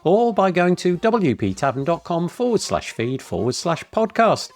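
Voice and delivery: polished announcer-style podcast intro; smooth, even radio-host delivery introducing the guest; podcast intro read. Acted. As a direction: read the show opener like a practiced host.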